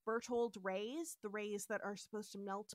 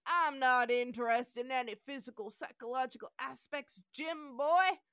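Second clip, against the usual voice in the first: put-on southern accent; 5 dB louder than usual. Podcaster's mock southern McCoy brushing off Kirk. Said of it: "Do you like my southern accent?".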